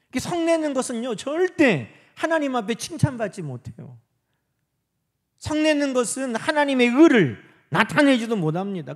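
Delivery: high, loud pulpit exhortation; forceful preaching. Real, not acted. Preacher warns congregation against holding anger, forceful exhortation.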